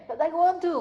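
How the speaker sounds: high, whining "but I want to"; whiny child. Pretend. Soul whining back at God.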